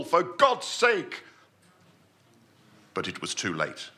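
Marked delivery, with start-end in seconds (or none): 0.0-1.2 a raised, higher desperate cry. A narrator's reading. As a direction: shout it in sudden alarm, losing composure. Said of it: "crying out to pull".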